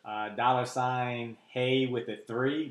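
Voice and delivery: slow, careful dictation; slow spelling out. Real, not acted. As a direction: read the handle out slowly. Spelling out Cash App handle.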